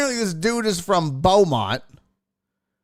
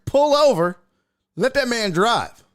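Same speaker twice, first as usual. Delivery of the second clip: mock command; 7 semitones higher, 3 dB louder than usual. Real, not acted. Joking order to viewer's father.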